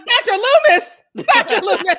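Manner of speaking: high, loud laughing plea; laughing loud mock plea to put it away; mock shriek. Podcaster shrieking at the actor on screen, laughing.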